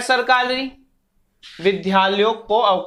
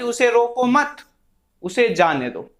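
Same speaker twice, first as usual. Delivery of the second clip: उसे रोको मत rising, pause, then उसे जाने दो falling; pause after मत. Shown as a demonstration. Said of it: "के बाद लगाया है". (मत पर सुर उठाकर विराम, फिर उसे जाने दो नीचे)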